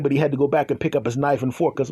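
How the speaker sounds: normal talking voice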